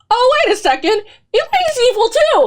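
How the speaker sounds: as if it just clicked; sudden realization. Retold or imitated. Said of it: "And then I was like".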